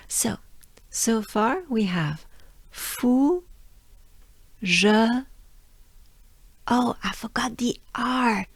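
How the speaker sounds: ordinary talk, nothing marked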